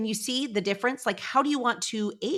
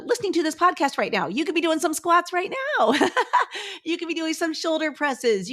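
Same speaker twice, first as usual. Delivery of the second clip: higher and louder, bubbling with energy; excited push; 8 semitones higher, 4 dB louder than usual. Real, not acted. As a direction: cheer the listener on, nearly laughing. Hyping listeners to exercise now.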